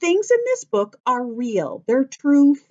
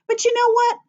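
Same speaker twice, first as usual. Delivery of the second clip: teasing question; 12 semitones higher, faster than usual. Real, not acted. Teacher building anticipation.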